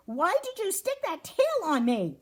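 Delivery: high-pitched aggrieved demand; hammed-up indignant storybook complaint; indignant question. A narrator's reading. Cork demands a reason from Fuzz, indignant.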